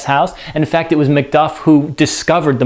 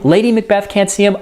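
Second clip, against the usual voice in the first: ordinary delivery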